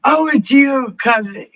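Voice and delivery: light up seeing Oh, it's you; excited greeting. Real, not acted. Streamer spots cousin in chat, delighted shout.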